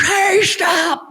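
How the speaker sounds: high mock police yell; a prankster's fake stop shout. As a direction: shout like a cop giving chase. Prankster yelling at fake intruder.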